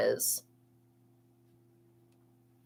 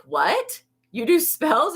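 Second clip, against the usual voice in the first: mock disbelief; 10 semitones higher, slower than usual. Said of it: "he was like".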